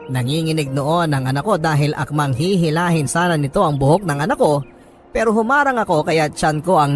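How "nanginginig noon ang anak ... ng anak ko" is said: ordinary talk, nothing marked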